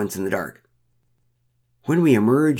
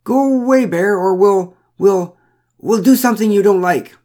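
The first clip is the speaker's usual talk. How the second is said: high, loud, faltering threat; unconfident shout; 9 semitones higher, 7 dB louder than usual. A narrator's reading. Woman threatens an unmoved bear, shouting less confidently. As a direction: shout it high, losing your nerve. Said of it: "this time not quite so confidently".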